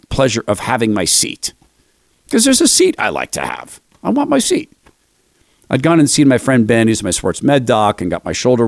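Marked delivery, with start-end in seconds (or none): 4.0-4.7 put-on high, sulky little-kid whine; whiny child. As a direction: pout it out like a spoiled child. Childish tantrum about his seat.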